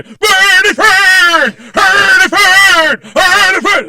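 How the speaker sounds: very high, slow shouted Swedish Chef gibberish. Retold or imitated. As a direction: squeal the mock-Swedish nonsense at the top of your lungs. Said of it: "I'm doing the chef from the Muppet Show".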